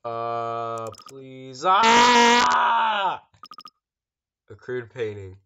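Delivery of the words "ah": sudden loud startled scream; a jolt of real fright, yelled out. Real, not acted. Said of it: "Sorry for the scream".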